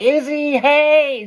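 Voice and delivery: high, loud delighted hello; mock bubbly waitress calling across the room; excited greeting. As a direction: call out like spotting an old friend. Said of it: "She's like".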